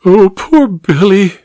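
slow, teary plea; high, tearful and slow; weepy. A narrator's reading. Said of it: "weepy eyes".

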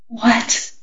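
a soft, frightened whisper. Acted. Alan afraid of the answer, soft whisper. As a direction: barely breathe the word. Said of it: "whispered Alan".

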